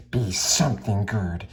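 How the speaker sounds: usual speaking voice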